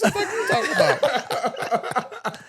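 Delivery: high, slow, laughing disbelief; mock exasperation; incredulous. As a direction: drag it out in comic confusion. Retelling his baffled reaction.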